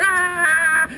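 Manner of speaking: high, loud, drawn-out yell; big acted "aah" on the run; running scream. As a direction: holler it as you charge off. Runs for the water.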